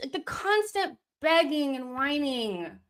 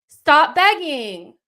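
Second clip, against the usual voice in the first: stretched yell; 5 semitones higher, 10 dB louder, slower than usual. Real, not acted. Repeated yell at players.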